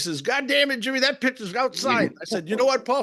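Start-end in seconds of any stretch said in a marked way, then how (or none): 0.2-2.1 high-pitched, indignant batter's complaint; angry protest. Retold or imitated. Retold batter protesting a strike call. 2.5-3.0 high-pitched retort to the batter; exasperated retort. Retold or imitated. Retold umpire snapping back at batter.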